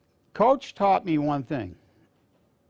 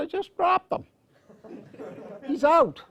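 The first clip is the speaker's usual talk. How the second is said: much higher, louder and strained; excited blurt; 3 dB louder than usual. Retold or imitated. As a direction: blurt it out as a shaken kid. Retelling to the principal.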